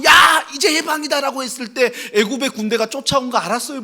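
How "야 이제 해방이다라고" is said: higher, loud jubilant shout; triumphant shout. Acted. Freed slaves shouting in triumph, loud jubilant cry. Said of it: "손 높이 들고".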